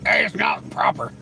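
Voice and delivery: lightly voiced churchy scold; mock preacher. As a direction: streamer mocks Jesus freaks preaching to a mod, hushed and pious. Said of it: "They'll be like".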